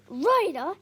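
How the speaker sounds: loud, sharp angry snap; snapping. Acted. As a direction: snap the name at him. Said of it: "Dad snaps".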